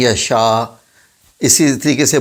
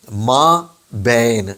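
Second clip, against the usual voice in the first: slow model word; much slower than usual. Shown as a demonstration. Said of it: "Urdu میں لفظ ہوتا ہے ما بین".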